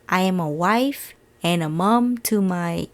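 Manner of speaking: ordinary talk, nothing marked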